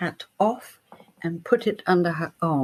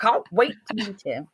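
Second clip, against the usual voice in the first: gushing producers. Retold or imitated. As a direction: gush like thrilled TV executives.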